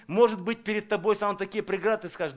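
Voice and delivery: usual speaking voice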